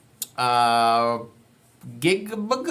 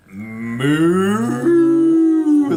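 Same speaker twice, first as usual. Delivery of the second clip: loud, drawn-out moo; cow moo; 4 dB louder than usual. Pretend. Showing kids the cow sound, big moo. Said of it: "he makes a sound like this".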